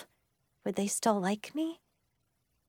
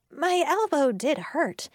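plain reading voice

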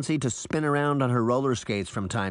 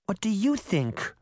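coaxing; 9 semitones higher than usual. Acted. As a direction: gently coax a shy one out. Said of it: "as if coaxing a shy puppy".

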